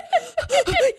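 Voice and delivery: big imitated gasp; breathless news gasp. Imitating someone arriving with big news.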